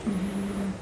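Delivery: ordinary delivery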